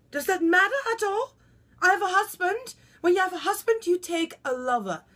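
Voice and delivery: high, loud, affronted retort; indignant self-defence. Acted. Wife defends having a lover, loudly affronted. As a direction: challenge the interviewer, pitch up.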